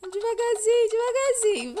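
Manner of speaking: high tense coaxing voice; nervous coaxing. Real, not acted. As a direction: coax yourself, scared. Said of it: "eu tô morrendo de medo".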